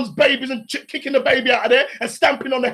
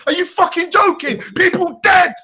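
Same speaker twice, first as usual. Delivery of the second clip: furious shout; 6 semitones higher than usual. Real, not acted. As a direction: yell it out in real anger. Outraged rant about massacre.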